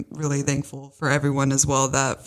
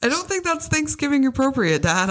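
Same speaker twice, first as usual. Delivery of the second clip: mock scolding; 11 semitones higher than usual. Daughter mock-scolds dad's joke.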